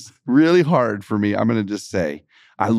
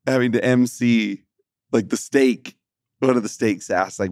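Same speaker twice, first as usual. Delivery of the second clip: ordinary delivery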